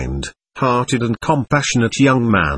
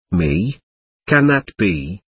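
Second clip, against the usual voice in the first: faltering; 4 semitones lower, slower than usual. A narrator's reading. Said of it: "she faltered".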